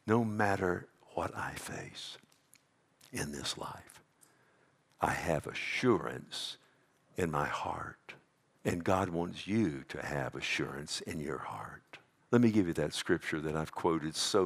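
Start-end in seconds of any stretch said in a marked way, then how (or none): 0.1-12.0 intimate whisper. Real, not acted. Pastor whispering assurance to his listeners, hushed whisper. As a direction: whisper it like a secret comfort.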